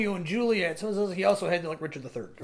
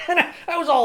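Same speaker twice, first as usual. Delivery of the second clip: ordinary talk, nothing marked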